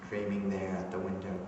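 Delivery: hushed, wistful reminiscence with long pauses; soft, low monologue, lost in childhood memory; Robert Mayo. Acted. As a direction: confide it quietly, pausing to remember. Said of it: "Robert Mayo from Eugene O'Neill's Beyond the Horizon".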